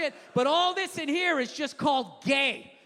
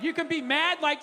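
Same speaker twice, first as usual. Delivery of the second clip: ordinary talk, nothing marked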